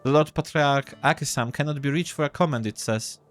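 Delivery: put-on high-pitched boy relaying party news; newsreading kiosk boy.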